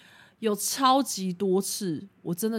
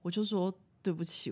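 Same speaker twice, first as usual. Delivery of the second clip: normal talking voice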